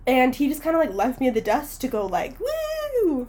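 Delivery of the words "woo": whooping. Retold or imitated. Imitating him cheering at concert.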